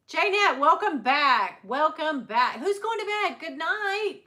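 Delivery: high, loud, sing-out welcome; calling out greetings. Real, not acted. Host greets returning viewer and sees others off to bed, calling out. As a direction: call out loudly and high to them.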